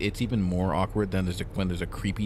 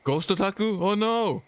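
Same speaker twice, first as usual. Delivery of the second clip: high, loud surprised cry; 13 semitones higher, 4 dB louder, slower than usual. Real, not acted. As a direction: react with sudden alarm. Reacting to game character.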